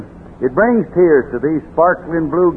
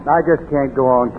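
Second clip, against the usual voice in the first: ordinary talk, nothing marked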